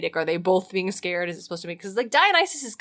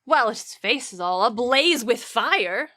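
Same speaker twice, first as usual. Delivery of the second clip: Xanthias, the sly slave, teasing. Acted. Reading Xanthias to the frightened Dionysus, hammed-up and excited. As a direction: play the servant spooking his master for fun.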